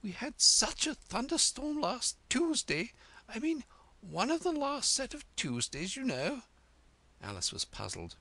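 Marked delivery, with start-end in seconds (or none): 0.0-6.4 high, fluttery queen recalling a storm; a hint of nerves as she rambles; nervous. A narrator's reading. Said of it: "nervously clasping and unclasping her hands".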